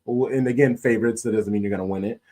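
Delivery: normal talking voice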